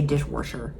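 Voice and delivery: "dishwasher" said "dishworsher" with an r; Wisconsin r. Shown as a demonstration. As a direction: slip an r into "dishwasher". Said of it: "I say".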